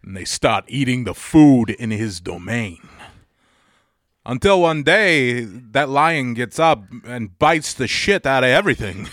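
monologue building from measured menace to emphatic climax; Christopher Walken. Acted. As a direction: stretch the odd stresses as the threat builds. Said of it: "when Walken gets quiet and threatening".

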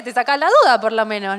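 ordinary delivery

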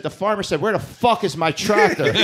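put-on high, loud child's punchline; imitated little kid. Young son shocking dad with punchline, high and loud. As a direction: blurt it proudly like a small boy, imitating. Five-year-old son's swearing punchline.

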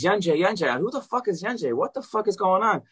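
high, excited bewildered crowd voices; confused crowd. Retold or imitated. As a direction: mimic a stunned crowd.

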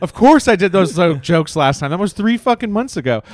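loud exasperated comeback; angry retort. Retold or imitated. Comic snapping back at a fan, loud and exasperated. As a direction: snap back at a fan who's heard the jokes. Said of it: "I'm like".

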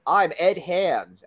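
slow, high podcast intro voice; announcer. Acted. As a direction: announce the show.

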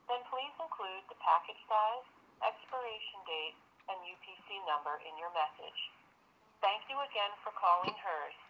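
automated phone greeting over the line; recorded message heard through the phone; phone menu voice. Real, not acted. Recorded customer service greeting.

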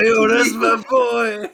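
long, high, loud whoop of recognition; hollered cheer. Pretend. Pretending to spot a friend in a video, drawn-out cheer. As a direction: holler it out, dragging the words.

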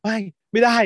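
mock group refusal. Stirred-up friends refusing to give in, mock group refusal. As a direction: stretch out a worked-up no.

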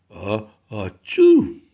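loud sneeze. Acted. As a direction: build up and sneeze. Goat's big sneeze.

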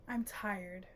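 low, very soft sigh of fatigue; weary. Real, not acted. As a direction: say it quietly, worn out. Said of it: "I am exhausted".

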